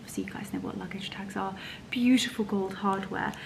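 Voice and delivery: quick, hushed whisper; soft whispered commentary. Real, not acted. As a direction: murmur it to the viewers. Admiring gift.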